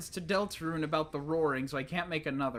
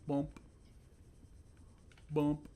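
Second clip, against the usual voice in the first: ordinary talk, nothing marked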